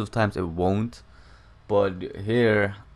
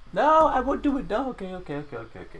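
imitated girlfriend; 12 semitones higher, faster than usual. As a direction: mimic your fiancée brushing off a request in falsetto. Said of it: "I told my girlfriend or fiance".

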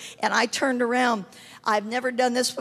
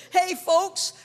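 higher-pitched call to a crowd; imitated announcement; 6 semitones higher than usual. Visiting pastor interrupts the congregation, lightly imitated calling out. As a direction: hail the room as a visiting pastor.

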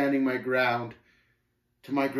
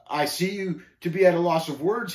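higher, louder smug triumph; gloating; 6 semitones higher, 6 dB louder than usual. A narrator's reading. Shame seeing the pilgrim at a loss, gloating. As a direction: relish the other's silence. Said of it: "he gloated".

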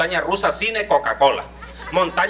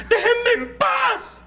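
put-on shrieking spoiled child; much slower than usual. Child screams from room.

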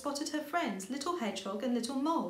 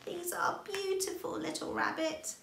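granny admiring the flowers; Granny Hedgehog voice; 8 semitones higher than usual. A narrator's reading. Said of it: "she said".

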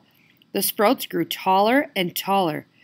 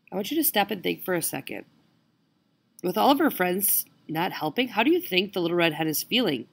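usual speaking voice

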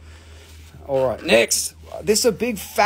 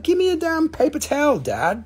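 voicing the pet; 8 semitones higher than usual. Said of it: "He's like".

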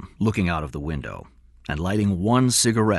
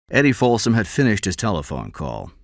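ordinary delivery

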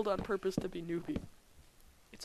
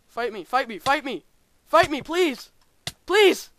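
shouting louder and higher with each plea; escalating pleading shout; 7 semitones higher, 14 dB louder than usual. Real, not acted. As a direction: beg loudly, building to a high yell. Begging enemies to fight in game.

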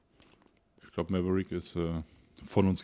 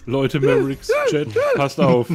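calling out; 4 semitones higher, 9 dB louder than usual. Real, not acted. Calling out to the chat.

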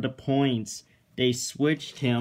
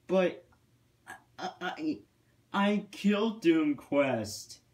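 hesitant, higher-pitched protest; stammering protest; 5 semitones higher than usual. A narrator's reading. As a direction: stumble into a bewildered objection. Thomas protests to threatening Philip.

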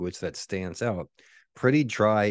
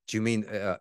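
normal talking voice

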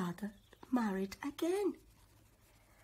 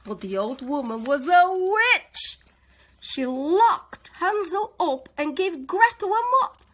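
much louder, high tense narration; dramatic narration; 7 semitones higher, 13 dB louder than usual. A narrator's reading. Narrator revealing the witch, loud and dramatic. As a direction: build the menace.

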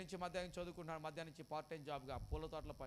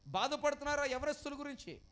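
loud, high, urgent sermon delivery; full-force preaching; 7 semitones higher, 7 dB louder than usual. Acted. Pastor warning the congregation, loud and high. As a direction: preach it out over the crowd.

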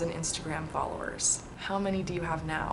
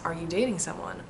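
ordinary talk, nothing marked

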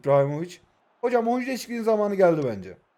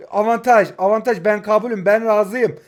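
rising into a loud, higher goal call; excited build-up; 3 semitones higher, 9 dB louder than usual. Real, not acted. Gamer calling his own attack, building to a loud goal shout.

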